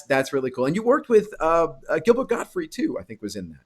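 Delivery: ordinary talk, nothing marked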